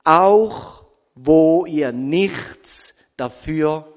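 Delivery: slow down and hammer each word; slow emphatic stress. Shown as a demonstration. Preacher driving home the verse's point to the church, slow and loud. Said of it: "Mit der Betonung auf".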